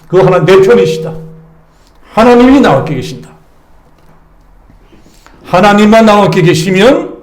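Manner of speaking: loud fervent sermon build; rousing pulpit cry; preaching at full force. Acted. Sustained preaching climax.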